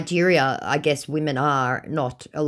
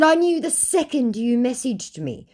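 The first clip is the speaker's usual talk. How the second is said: much higher-pitched quoted comeback; high voice for quoting your own reply; high-pitched retort; 9 semitones higher than usual. Retold or imitated. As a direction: deliver the reply high, well above normal. Quoting own taunting reply to scammer.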